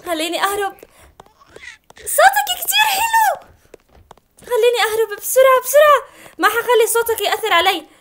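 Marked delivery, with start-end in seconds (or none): 2.0-3.5 very high, slow squeaky coo; mock sweet coo. Mock-cooing at the baby's sweet voice. 4.4-6.3 panicked hurry. Real, not acted. Fleeing the giant baby.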